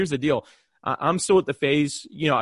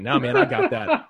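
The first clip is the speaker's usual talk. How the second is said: high, slow, laughing boast; laughing brag; 4 dB louder, slower than usual. Retold or imitated. Teasing a famous guest over the table leader card, laughing. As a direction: gloat through a laugh.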